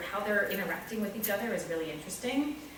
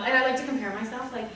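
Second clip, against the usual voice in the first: ordinary delivery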